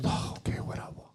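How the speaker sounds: breathy, barely voiced whatever; mock sulky murmur; reluctant mutter. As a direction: mumble it under your breath, sulking. Wife mutters reluctantly in kitchen.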